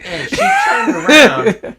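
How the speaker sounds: high, loud laughing narration. Real, not acted. Telling a friend the bus story, bursting into loud laughter. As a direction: blurt it out through laughter.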